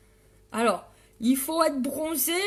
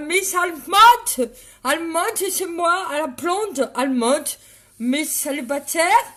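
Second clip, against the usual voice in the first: loud mock German accent; 3 semitones higher, 7 dB louder than usual. Streamer crowning herself Miss Allemande, mock German accent. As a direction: punch it out in a hammed-up German accent. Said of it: "Je fais l'accent allemand".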